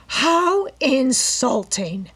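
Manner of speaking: insulted widow crying out at the will; indignant cry. A narrator's reading. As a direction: wail it in offended fury. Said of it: "Missus Topham cried".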